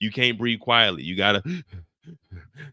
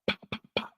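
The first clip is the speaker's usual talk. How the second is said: whispered gunshots; 7 dB quieter than usual. Retold or imitated. Gunshots imitated.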